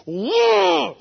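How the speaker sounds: high drawn-out mad "whoa"; angry outburst. Retold or imitated. Husband retelling being slapped, mad outburst. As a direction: let out a long, high howl of anger. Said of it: "I was so mad".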